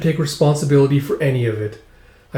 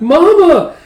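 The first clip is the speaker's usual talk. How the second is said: put-on high loud child's yell; crying out; 9 dB louder than usual. Yoko yelling for Mama, high loud child cry. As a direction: call out for her mother. Child yells toward mother.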